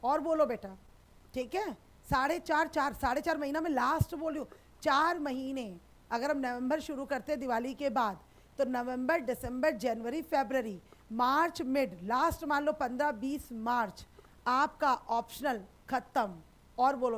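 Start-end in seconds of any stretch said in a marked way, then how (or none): none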